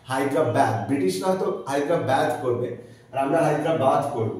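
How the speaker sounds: ordinary delivery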